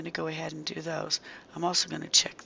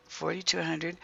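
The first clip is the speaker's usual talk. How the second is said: ordinary delivery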